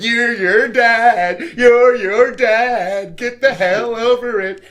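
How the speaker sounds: loud, high, slow exasperated yell; exasperated shout. Retold or imitated. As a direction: shout it out, fed up. Voicing exasperated retort in story.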